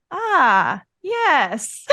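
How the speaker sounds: lightly voiced sly friend catching on; mild mock of the friend's knowing tone. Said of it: "my friend goes".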